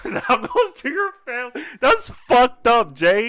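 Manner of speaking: loud, high, squealing laughter through the words; cracking up in disbelief; incredulous laughing. Real, not acted. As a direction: shout it while laughing helplessly. Cracking up at the grim fact.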